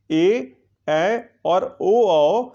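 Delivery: each vowel drawn out very slowly; slow dictation. Shown as a demonstration. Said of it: "teacher dictating vowel list". (स्वरों को बहुत धीरे-धीरे बोलना)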